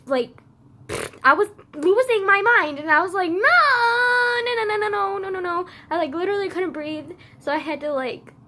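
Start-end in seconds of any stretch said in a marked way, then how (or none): 3.3-5.7 high, loud panicked no's fading out; panicked crying. Retold or imitated. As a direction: cry no over and over, losing control. Panic attack, repeating no.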